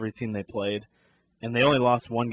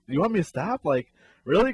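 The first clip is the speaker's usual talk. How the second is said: mild mock yell, pitched up; incredulous question called out, higher than usual; retold scream; 6 semitones higher, 4 dB louder than usual. Runner to teammate coaching third, retold scream. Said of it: "I remember screaming to you".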